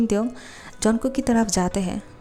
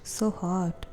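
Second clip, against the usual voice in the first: soft murmur; 6 dB quieter than usual. Acted. Younger cousin staring at his brother by the pool, softly murmured. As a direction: let it slip out softly, almost to himself.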